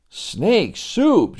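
put-on a kid amazed at the idea; surprised boy. Joey surprised.

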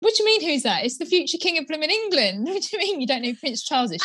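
high-pitched disbelieving outburst; astonished, rushing to the end; incredulous. Retold or imitated. Retold outraged reply to niece.

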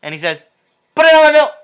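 level setup, high loud punchline; flat setup lively punchline. Acted. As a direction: tell it flat, then pop the punchline. Joke setup then punchline.

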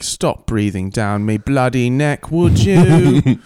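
hammed-up cockney complaint; mock British accent. Analyst snapping at a coworker, mock British accent. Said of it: "she's like".

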